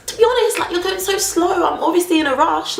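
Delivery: high-pitched retold exasperation; pleading annoyance at someone dawdling; exasperated. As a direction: act out impatience, pitch shooting up. Retold snapping at slow cashier.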